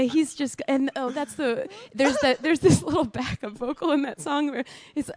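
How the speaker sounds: ordinary talk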